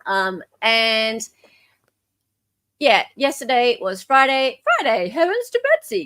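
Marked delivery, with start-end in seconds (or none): 4.8-6.1 high, fast mock old-lady exclamation; a fussy old auntie clutching her pearls; prissy old lady voice. Host hamming up Friday for her co-host, high fussy old lady. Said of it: "Can you do this voice".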